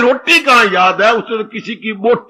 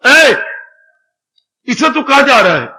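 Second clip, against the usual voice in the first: louder, higher father calling out; sharp challenge; 4 semitones higher, 4 dB louder than usual. Acted. Father stops the servant in the lane, sharp challenge. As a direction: call out to stop someone in their tracks.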